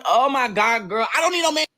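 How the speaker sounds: high-pitched mock exasperation; exasperated protest. Diner brushing off a matchmaking server, exasperated. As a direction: protest in a high, put-upon voice.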